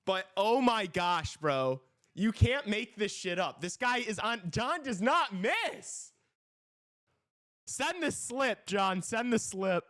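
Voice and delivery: high, drawn-out hype; frat bro. Real, not acted. Hyped shouting at John.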